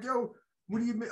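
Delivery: high, incredulous laughing question; a mock-shocked squeak of disbelief; stunned disbelief. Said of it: "I'm like".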